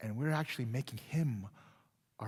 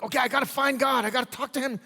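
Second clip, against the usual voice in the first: anxious striving; 11 semitones higher, 10 dB louder, faster than usual. Retold or imitated. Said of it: "we're not like".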